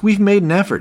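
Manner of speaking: insistent repeat. Retold or imitated. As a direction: say it again, pushing back hard.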